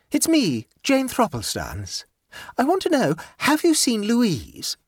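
a hint of a pleased, scatterbrained aunt; light, self-satisfied chatter; gaily. A narrator's reading. Jane's voice.

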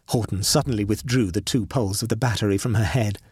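plain reading voice

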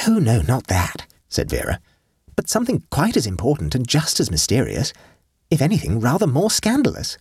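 put-on high, light young girl's voice; girlish Vera voice. Vera tells her aunt about Betsy, girlish voice. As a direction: lift into a teenage girl.

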